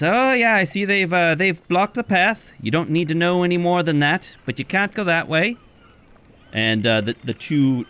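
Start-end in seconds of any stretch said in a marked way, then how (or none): 0.0-5.6 much higher, brushing them off; evasive barkeep. Acted. Barkeep hides the blocked path from the party, high evasive voice. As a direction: cheerfully dodge the question. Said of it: "and says".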